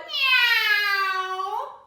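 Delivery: high, long, loud meow; loud cat meow. Acted. Storyteller as cat scared by the door, loud meow. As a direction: yowl like a startled cat. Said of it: "the cat said".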